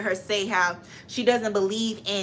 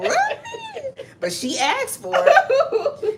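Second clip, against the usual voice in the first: laughing disbelief; 4 dB louder, much slower than usual. Real, not acted.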